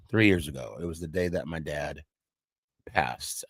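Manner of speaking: holding back tears as the words fade out; choking up. Real, not acted. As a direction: voice sinking to a near-silent, tearful murmur. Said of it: "I'm not gonna get emotional".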